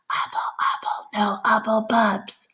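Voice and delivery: hushed, secret chant; boy whispering the secret command; whispered magic words. A narrator's reading. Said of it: "then he whispered".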